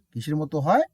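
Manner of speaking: usual speaking voice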